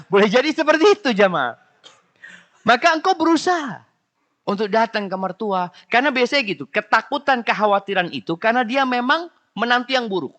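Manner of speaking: high, loud preaching. Real, not acted. Preacher tells daughters-in-law to visit the mother-in-law, loud and high. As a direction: drive the point home loudly.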